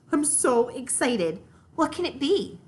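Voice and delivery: bubbling over with curiosity; excited. A narrator's reading. Little girl eagerly following her siblings upstairs, excited.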